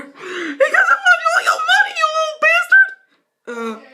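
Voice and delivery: very high, loud squeaky villain yell; squeaky cartoon robber. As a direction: screech the demand like a tiny crook. Voiced robber demanding money.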